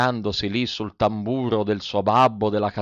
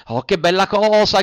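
higher, louder, delighted shout; joyful cry; 7 semitones higher, 6 dB louder than usual. A narrator's reading. Pinocchio shouts for joy at the fox's promise. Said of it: "gridò Pinocchio ballando dall'allegrezza".

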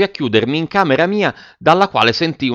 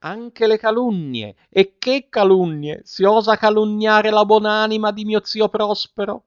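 a scandalised matron, wounded pride on every word; offended old lady; 9 semitones higher than usual. A narrator's reading. Sora Matilde to Giannino, high and outraged.

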